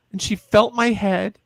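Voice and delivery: normal talking voice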